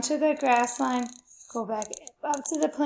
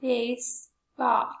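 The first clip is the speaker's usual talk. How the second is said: BASEBALL in two slow, separate beats; syllable by syllable; slower than usual. Shown as a demonstration. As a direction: sound out BASEBALL as "base... ball". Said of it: "How many times does your chin go down".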